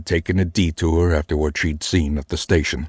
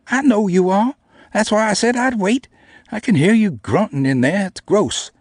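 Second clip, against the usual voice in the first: high, loud mocking small girl; 3 dB louder than usual. A narrator's reading. Namie mocking the narrator about the weights, high and loud. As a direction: tease him mercilessly in a high voice.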